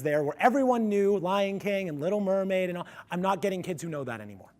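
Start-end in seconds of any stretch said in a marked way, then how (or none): none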